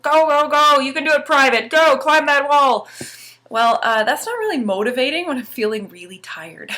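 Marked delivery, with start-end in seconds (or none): 0.0-2.9 higher, louder barking drill sergeant. Retold or imitated. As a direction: bark orders at a private. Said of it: "I sound like a drill sergeant".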